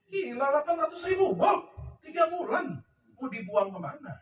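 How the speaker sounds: high-pitched, incredulous dismay; retold alarm. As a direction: replay your shock at an impossible problem. Menirukan paniknya melihat stok menumpuk. (menirukan kaget, nada tinggi)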